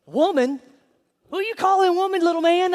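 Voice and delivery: a mom daring her son to repeat that; hammed-up outraged mama snapping back; sassy offended mama.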